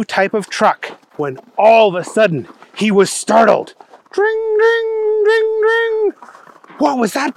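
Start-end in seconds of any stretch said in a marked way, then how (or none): 4.1-6.1 high, sustained ringing; imitated phone ring. Telephone rings in the story. 6.8-7.4 high-pitched, startled character voice; startled Booger character. Acted.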